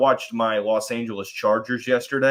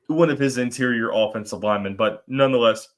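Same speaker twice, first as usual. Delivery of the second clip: usual speaking voice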